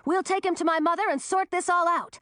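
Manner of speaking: high, urgent calling voice; shouted warning. A narrator's reading. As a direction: keep it high and urgent, giving orders. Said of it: "shouted Millie".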